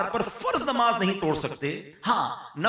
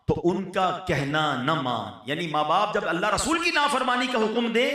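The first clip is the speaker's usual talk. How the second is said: ordinary talk, nothing marked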